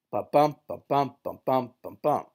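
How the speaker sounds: four "ba-bum" beats with BUM stressed; four-foot beat. Shown as a demonstration. Teacher demonstrates the old four-foot line, chanted. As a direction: chant four even iambs, then stop. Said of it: "Chaucer has been writing in four-foot lines".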